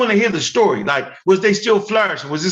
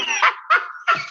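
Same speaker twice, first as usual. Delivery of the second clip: real laughter, pitched high. Real, not acted. Learning he said it wrong for years, high laughter.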